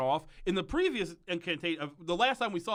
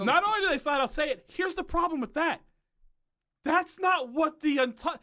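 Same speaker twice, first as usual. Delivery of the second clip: higher and louder, rising into an indignant rant; real annoyance; 12 semitones higher, 5 dB louder than usual. Real, not acted. Co-host ranting about the show's misused line, indignant and louder. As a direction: let the irritation push the pitch up. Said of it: "it made me so mad when he said it".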